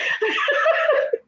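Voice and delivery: breathy high giggle; airy laugh. Real, not acted. Laughs after guest's quip.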